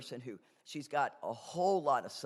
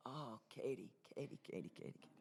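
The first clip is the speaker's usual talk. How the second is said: soft, patient sighing of her name; God gently calming a stubborn Katie; gentle God voice; 3 semitones lower, 14 dB quieter, faster than usual. Retold or imitated. Speaker imitating God soothing stubborn Katie, very soft and patient. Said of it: "And God is just like".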